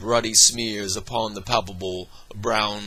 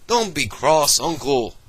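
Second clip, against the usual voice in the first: nephew coaxing; 4 semitones higher, 5 dB louder than usual. Acted. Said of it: "said the nephew".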